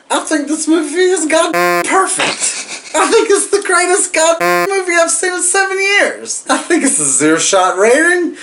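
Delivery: loud, high, giddy slurred rave. Real, not acted. Excited drunken movie rave.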